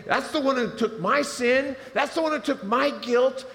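loud, high, driving preaching; full-force preaching. Real, not acted. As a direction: preach it out to the whole room.

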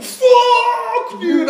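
high, loud yelp of disappointment; dismayed cry. Retold or imitated. Telling friends about the male plants: high loud dismayed yelp. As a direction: yelp it like the crop just died.

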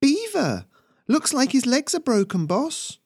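very high, loud bird squawk; bird calling out. A narrator's reading. Bird calls its boss over a survivor, high squawking call. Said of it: "one of the birds called".

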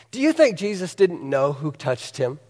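usual speaking voice

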